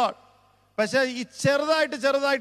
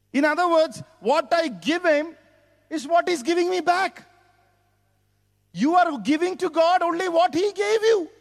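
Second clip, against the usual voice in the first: higher, emphatic preaching voice; 6 semitones higher, 4 dB louder than usual. Real, not acted. Preacher explaining giving back to God, emphatic preaching. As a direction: press the point home, pitched up and forceful. Preacher drives the point home.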